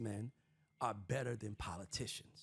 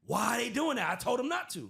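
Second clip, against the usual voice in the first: high, loud mock Trump outburst; 10 semitones higher, 8 dB louder than usual. Host mimics Trump denying on TV, high and loud. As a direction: squeal it like an indignant Trump. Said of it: "He said".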